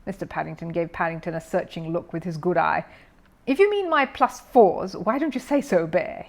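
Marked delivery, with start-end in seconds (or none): 3.5-6.2 growled. A narrator's reading. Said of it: "he growled".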